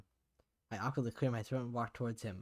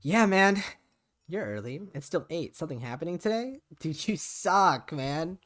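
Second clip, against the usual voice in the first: casual dude voice; 6 semitones higher, 8 dB louder, slower than usual. Acted. Voicing a casual friend.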